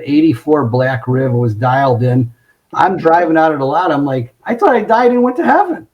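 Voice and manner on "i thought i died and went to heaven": high-pitched, acted-out elation; retold giddy delight, pitched way up; thrilled wonder. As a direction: marvel at an unbelievable stroke of luck. Rookie thrilled with his demo car.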